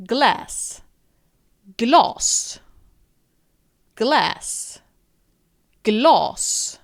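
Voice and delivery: glas with a long open a, Glass with an English short a; alternating Glass and glas. Shown as a demonstration. Teacher drilling the Swedish and English pair. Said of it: "pronounced as glas".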